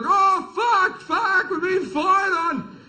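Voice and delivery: high, loud panicked cry, slowed on the last words; mock terror as the bullets come up; panicked yelp. Comic panics in the helicopter as a man fires up, high frightened yelp.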